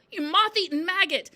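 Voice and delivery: loud, high tirade; angry yelling. A narrator's reading. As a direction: berate the pupil at the top of your voice. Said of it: "yelled the Trunchbull".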